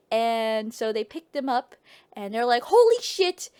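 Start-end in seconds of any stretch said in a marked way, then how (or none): 2.6-3.5 high-pitched exclamation; acted amazement. Couple amazed by the baby they found, acted amazement. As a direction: blurt it out in mock astonishment.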